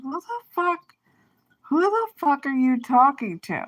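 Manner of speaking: high, indignant what the fuck; incredulous angry comeback; outraged retort. Retold or imitated. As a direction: fire back at the insult. Retold angry confrontation.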